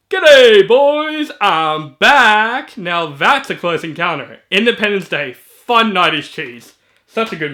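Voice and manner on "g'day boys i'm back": big, loud triumphant holler; bellowed film quote. Acted. Quoting Independence Day to introduce it, loud holler. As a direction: holler it out, pitched up.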